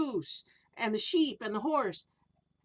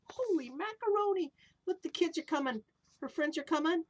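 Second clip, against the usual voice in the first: plain reading voice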